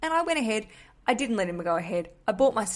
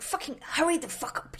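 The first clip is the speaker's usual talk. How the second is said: mock impatient grumbling, pitched up; impatient grumble; 7 semitones higher than usual. Posh man pestering the queue in front, impatient grumble. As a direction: grumble it hurriedly, imitating a fed-up posh man. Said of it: "he had a little grumble".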